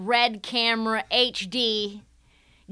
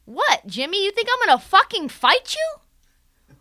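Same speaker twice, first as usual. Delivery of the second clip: higher, rising disbelief; hammed-up coach outburst; mock Spoelstra outburst; 8 semitones higher than usual. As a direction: snap it like an incredulous coach. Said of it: "he's like".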